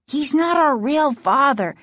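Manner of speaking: high, agitated denial; crying out. A narrator's reading. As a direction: cry it out as a frightened accusation. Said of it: "Casey cried".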